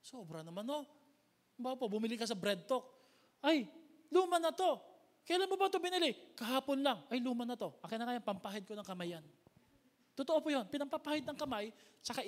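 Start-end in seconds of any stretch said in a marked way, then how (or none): 3.4-9.2 fussy customer. Retold or imitated. Acted bakery customer dialogue.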